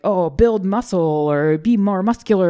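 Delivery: hint of hyped cover-line voice; mock magazine headline. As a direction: lightly parody a muscle magazine pitch. Mimicking men's magazine headlines.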